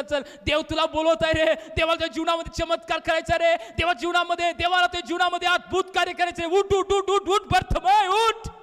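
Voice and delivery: excited crowd cheering him up; urgent rousing call. Acted. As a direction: build to a high, loud summons. Crowd urges the blind man up.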